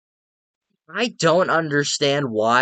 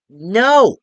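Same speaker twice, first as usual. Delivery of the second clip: a loud, higher, outraged "No!"; emphatic no; 8 semitones higher, 8 dB louder than usual. Real, not acted. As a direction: burst out with a flat refusal. Emphatic denial to own question.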